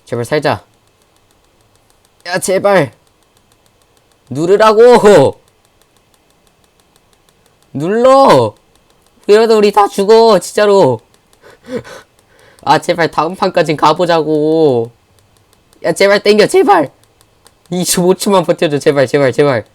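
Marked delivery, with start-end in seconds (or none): 2.3-11.0 real high, loud panicked yelling; desperate shouting. Real, not acted. Streamer begging teammates to press, desperate high shouting. Losing team contest, urging teammates. 12.6-19.7 loud, raised-pitch begging; desperate pleading. Real, not acted. Streamer begging team for the next round, loud desperate plea.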